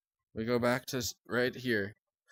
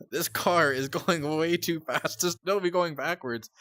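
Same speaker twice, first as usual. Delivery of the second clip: higher, slow amused disbelief; laughing while talking; 5 semitones higher, 3 dB louder, slower than usual. Real, not acted. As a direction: laugh through the words slowly. Laughs at replay.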